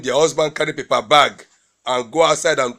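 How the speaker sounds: ordinary talk, nothing marked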